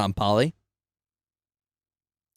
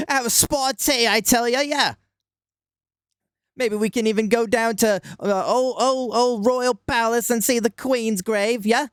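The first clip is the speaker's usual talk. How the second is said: much higher, hammed-up Cockney British accent; 10 semitones higher than usual. Asked for a British accent, hammed-up high British Cockney. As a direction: play an old-timey London geezer in a British accent. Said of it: "My best British accent".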